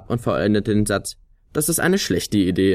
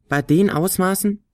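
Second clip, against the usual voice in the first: high doubting flute voice; Flöte; 10 semitones higher than usual. A narrator's reading. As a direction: scoff at the size. Flöte zweifelt ungläubig. (hohe, zweifelnde Flötenstimme)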